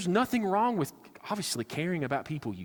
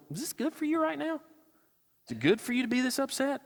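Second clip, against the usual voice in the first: gentle God voice; 12 semitones higher than usual. Retold or imitated. Preacher voicing God calmly asking an upset Jonah. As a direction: ask like a patient counselor.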